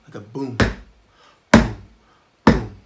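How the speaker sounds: deep booming mouth drum; low vocal boom. Shown as a demonstration. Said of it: "It's kind of a low sound".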